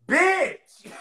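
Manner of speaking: high, loud mock cry of protest; sudden yelped imitation; imitated tearful outburst. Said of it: "you had me laughing 'cause you was, like, crying".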